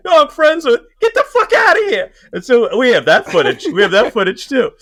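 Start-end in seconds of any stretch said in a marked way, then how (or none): 0.1-2.1 high, loud incredulous yell; mock outburst. Filmer caught out by Pesci, mock shout back. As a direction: shout in disbelief, hammed up. Speaker's own retold outburst.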